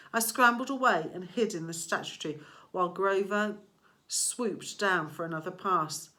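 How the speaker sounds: ordinary delivery, nothing marked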